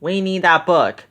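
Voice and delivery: ordinary delivery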